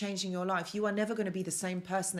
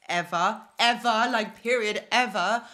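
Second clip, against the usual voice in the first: louder, each ever punched; amazed insistence, louder on each ever; emphatic repetition; 2 semitones higher, 8 dB louder than usual. Real, not acted. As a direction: hammer every ever home. Emphatic disbelief at own success.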